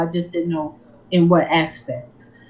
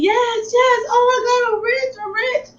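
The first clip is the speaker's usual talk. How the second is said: much higher, near-crying cries of joy; ecstatic screaming. Retold or imitated. Alone in the room after the trade won, shrieking with joy. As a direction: squeal it like you just struck it rich. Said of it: "I'm screaming".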